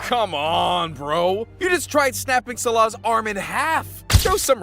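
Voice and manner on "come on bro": usual speaking voice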